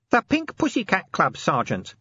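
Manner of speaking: high-pitched dutiful report; obedient. A narrator's reading. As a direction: answer your sergeant obediently. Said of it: "said Nobby obediently".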